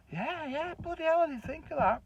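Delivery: sudden hope of treasure; eager excitement. Retold or imitated. Husband thrilled there might be money, eager and high.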